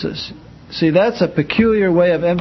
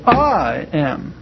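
"I" stretched out with a big swoop, then "am"; emphatic inflection. Shown as a demonstration. Teacher showing how speaking can emphasize a word, emphatic "I". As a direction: punch and draw out the word "I". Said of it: "You can use inflection".

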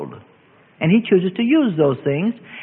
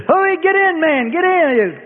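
urgent yell; 11 semitones higher, 4 dB louder, slower than usual. Acted. Rescuer urging from helicopter.

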